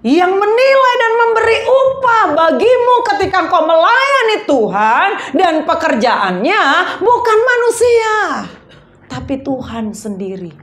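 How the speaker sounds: emphatic sermon delivery; preaching. Real, not acted. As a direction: preach it forcefully with punched key words.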